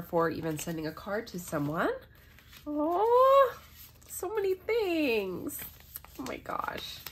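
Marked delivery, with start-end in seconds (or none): 2.7-5.6 1 delighted surprise. Real, not acted. Unpacking a gift. 6.2-7.0 1 awed whisper. Real, not acted. Unpacking a gift.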